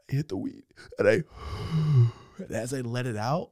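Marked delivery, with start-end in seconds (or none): 1.3-2.1 long breathy blow; acted smoker's exhale.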